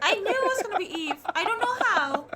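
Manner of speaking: loud, high, fast gleeful shout; excited yell. Real, not acted. Friend gleefully shouting she guessed who the story is about. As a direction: crow that you called it.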